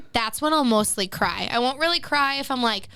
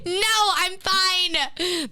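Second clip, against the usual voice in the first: high, long drawn-out refusal; mock protest; 8 semitones higher, much slower than usual. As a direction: stretch it out in high-pitched protest. Mock refusal of dad's financial advice.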